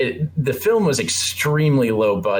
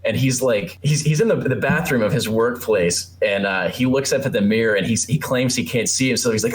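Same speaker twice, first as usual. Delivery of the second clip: ordinary talk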